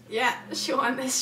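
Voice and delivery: normal talking voice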